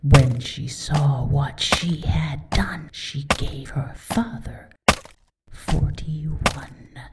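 slow eerie whisper; whispered rhyme. Acted. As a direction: breathe the rhyme out slowly.

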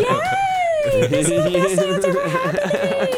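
very high, loud sing-song yay; hammed-up delighted mom; over-the-top cheer. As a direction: gush like a mom hamming up praise. Mom cheering toddler's climbing.

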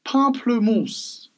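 "pamplemousse" slow and high, pahm-pluh-moos; fancy French. Shown as a demonstration. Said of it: "You gotta be fancy with it".

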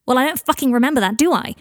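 angry snap. Acted. Dean snaps at Harry.

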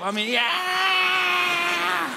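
high, drawn-out rock shout; mock metal yell. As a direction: belt a stretched-out yeah like a metal singer. Imitates heavy metal singer.

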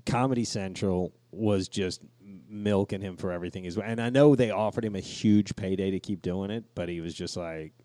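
usual speaking voice

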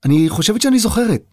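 high, wondering realisation; tentative self-surprise; surprising herself. A narrator's reading. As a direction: say it as if just discovering it. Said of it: "כאילו הפתיעה את עצמה".

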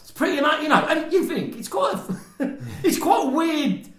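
words broken up by real laughter; can't keep a straight face, high and giggly; laughing while talking. Real, not acted. Laughing as he tells his co-host about the weird score.

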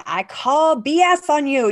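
slow, high, loud taunt; mock defiant callout. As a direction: call the bluff with playful defiance. Mock calling out a lie.

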